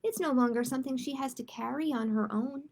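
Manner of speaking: ordinary delivery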